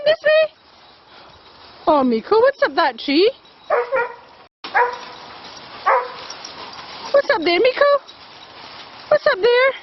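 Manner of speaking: high fond calling voice; doggy talk. Real, not acted. Owner coaxing her dog away from a squirrel tree, high fond call.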